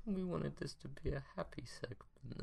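hushed unvoiced whisper. Real, not acted. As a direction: whisper it like a secret. Mock scolding aside.

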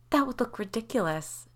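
put-out mother protesting. A narrator's reading. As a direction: object in mild disbelief. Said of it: "Jamie protested".

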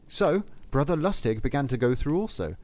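usual speaking voice

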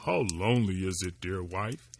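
lightly voiced woodman. Woodman to his wife by the fire, lightly low and wistful. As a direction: sigh it out as a lonely husband.

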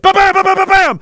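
loud, high rapid gunshot noises; imitated gunfire. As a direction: fire off shots with your voice. Imitating point-blank gunfire.

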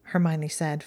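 plain reading voice

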